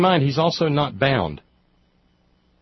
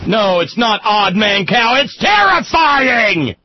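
much higher, louder, stretched yell; furious shout; 12 semitones higher, 12 dB louder, slower than usual. Real, not acted. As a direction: bellow it out, drawing out terrifying. Host cuts in, outraged at guest.

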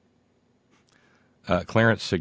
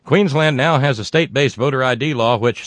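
emphatic reread; 5 semitones higher, 5 dB louder than usual. Acted. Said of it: "I'll never forget banana benders".

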